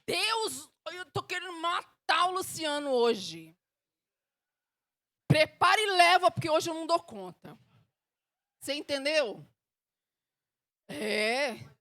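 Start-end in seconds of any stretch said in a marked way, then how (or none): 0.0-7.6 raised, high-pitched outburst to God; blunt honest prayer. Acted. Woman confessing murderous mood to God, loud and blunt. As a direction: let it out loudly, exasperated. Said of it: "o que Deus quer é que você saia de e fale assim".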